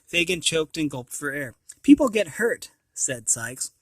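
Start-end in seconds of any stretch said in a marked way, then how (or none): 1.8-2.7 threat. Acted.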